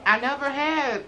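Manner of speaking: chewing while talking; eating between words; mouth full. Real, not acted. Seen 0: Holds pizza slice, bit it earlier; jaw chewing motions while speaking.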